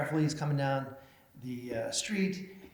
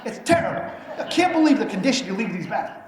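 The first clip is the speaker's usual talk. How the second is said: indignant complaint; 7 semitones higher, 10 dB louder than usual. Retold or imitated. Man complains about bathrooms.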